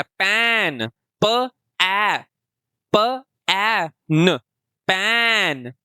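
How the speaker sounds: पैन sounded out letter by letter with a short ऐ; short a blending. Shown as a demonstration. Teacher sounds out पैन for beginning readers. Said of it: "short vowel sound".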